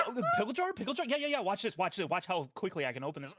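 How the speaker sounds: high, excited, tumbling repeats; imitating an over-eager guy showing off; eager golden retriever. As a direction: fall over yourself to help, like an eager puppy. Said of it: "they turn into golden retrievers".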